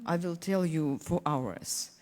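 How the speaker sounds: normal talking voice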